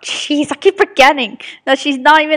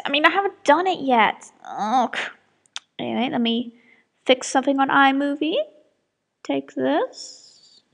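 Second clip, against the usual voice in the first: ordinary delivery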